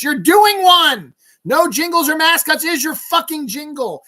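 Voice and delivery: high, loud angry rebuttal; exasperated shout. Real, not acted. Ranter calling out the insurer's hypocrisy, shouting. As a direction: yell it back at them in outrage. Exasperated rant at the company's slogan.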